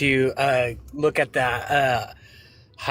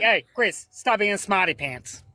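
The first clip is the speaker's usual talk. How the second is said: put-on Boston accent; 5 semitones higher, slower than usual. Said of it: "he's like".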